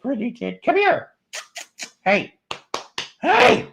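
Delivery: high sing-song cat-calling voice. Real, not acted. Streamer coaxes the cat toward the camera in a high call.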